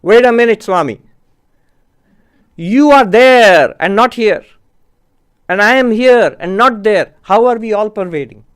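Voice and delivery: mildly acted challenge called up to the teacher; challenging objection. Monk at the back questions the Swami's teaching, challenging objection.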